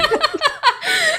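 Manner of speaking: real laughter bursting out high; helpless high laugh at the story; high laughing fit. Real, not acted. Laughs at partner's Italian.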